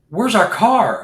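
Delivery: pitched high, startled "Where's our car?"; alarmed question. Retold or imitated. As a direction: sound suddenly shocked the car is gone.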